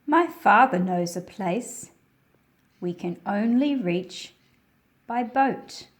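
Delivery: projected read-aloud narration; reading to children. A narrator's reading. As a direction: read the picture book out to a class of kids. Reads picture book aloud.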